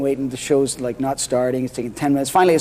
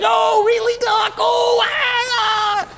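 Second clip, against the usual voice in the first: much higher, overacted shout; hammed-up Mickey Rooney; slower than usual. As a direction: wail it like a melodrama. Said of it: "He's like".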